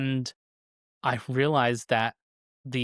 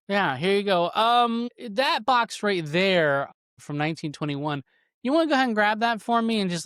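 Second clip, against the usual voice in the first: mock agreeable; 6 semitones higher than usual. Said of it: "and I'd be like".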